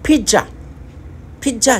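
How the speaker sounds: पिज़्ज़ा said high as pija with a j sound; Korean accent. Shown as a demonstration. Teacher showing Korean पिज़्ज़ा, Korean accent. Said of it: "Korean language में पिज़्ज़ा".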